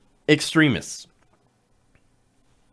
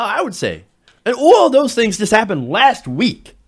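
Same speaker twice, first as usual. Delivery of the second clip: usual speaking voice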